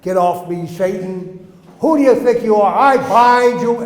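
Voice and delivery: loud, rising rebuke of Satan; furious exorcist shout; yelling at demons. Retold or imitated. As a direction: cast the demons off, full force. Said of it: "I'm yelling at the demons".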